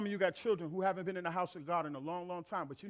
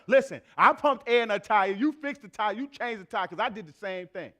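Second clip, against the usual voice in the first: 1 future husband boasting; 2 semitones higher, 10 dB louder than usual. Retold or imitated. Voices future son-in-law.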